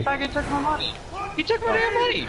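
enraged. Real, not acted. Gamer rages at the monkey that stole his money, high outraged yell. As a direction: seethe over the stolen money.

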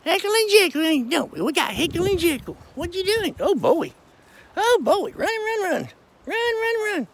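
squeaky high voice for the goats; high pet talk. Real, not acted. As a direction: coo up high like talking to babies. Calling to his pet goats.

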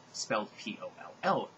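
ordinary talk, nothing marked

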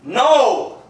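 loud. Real, not acted. Denies again, laughing.

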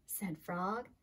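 ordinary delivery, nothing marked

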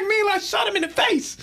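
high laughing yell; laughing shout. Retold or imitated. Laughing retelling to Mac.

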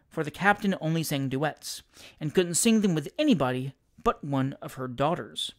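usual speaking voice

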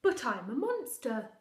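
slow, loud pleading monster; insist, drawn out; monster protest. Acted. Monster protesting to the mother, slow and loud.

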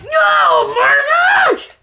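high, loud oh my gosh; shocked cry. Real, not acted. Gamer knocked off the parkour, loud shocked cry. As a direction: yell at the sudden fall. Falls off the course.

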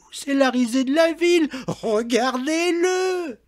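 very high, loud yelping taunt; shrieking fury. A narrator's reading. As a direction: screech it at him, mocking. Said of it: "elle glapissait".

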